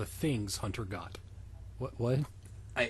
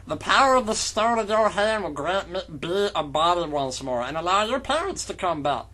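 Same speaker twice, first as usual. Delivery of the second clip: higher, hammed-up villain voice; 6 semitones higher than usual. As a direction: play the evil voice over the top. Voicing the villain in fanfic.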